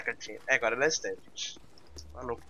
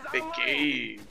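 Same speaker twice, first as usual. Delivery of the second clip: ordinary delivery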